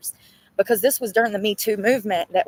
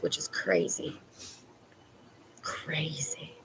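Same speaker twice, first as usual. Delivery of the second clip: hushed, much softer aside; whisper; 5 semitones lower, 13 dB quieter, slower than usual. Real, not acted. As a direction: whisper it like gossip.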